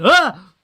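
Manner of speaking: sharp high yelp of fright; startled yelp. Retold or imitated. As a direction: yelp like flames leapt up. Dave's retold startled yelp.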